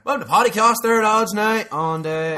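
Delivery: high, loud put-on Newry accent; thick mock Newry accent, hammed up. Showing the old Newry coming out, loud Newry accent. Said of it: "that accent you put on".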